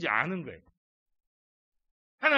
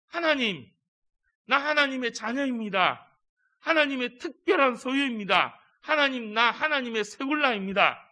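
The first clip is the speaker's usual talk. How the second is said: proud declaration; 7 semitones higher, 6 dB louder than usual. Acted. Believer calling to God.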